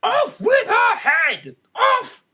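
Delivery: high, slow, furious shriek; the Queen screams her death sentence. A narrator's reading. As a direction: scream it at the top of your lungs.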